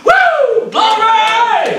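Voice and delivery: high whooping cry of praise, then a shouted glory; joyful shout. Real, not acted. Preacher whooping glory to the church, jubilant shout. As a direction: let the joy burst out in a loud high whoop.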